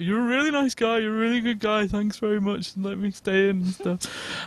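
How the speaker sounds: put-on high, slurry drunken thanks; drunk gushing. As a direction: gush thanks like a sleepy drunk.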